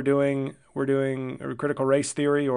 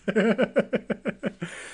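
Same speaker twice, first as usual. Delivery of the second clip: high giggle in a long run; high, rippling chuckle; laugh; 8 semitones higher than usual. Real, not acted. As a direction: giggle at your own joke. Laughs at own bit.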